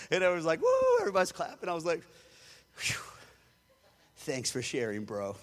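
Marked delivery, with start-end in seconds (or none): 0.6-1.0 high, loud mock crowd whoop; crowd woo. Crowd cheering the preacher. 2.7-5.4 lower, laughing dry aside; wry sarcasm. Retold or imitated. Reacting to a boastful preacher.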